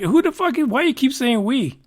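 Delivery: indignant. Real, not acted. Interrupts quote indignantly.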